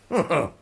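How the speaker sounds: a short scornful laugh; mocking laugh. Professor scoffing at Holmes's claim, short mocking laugh.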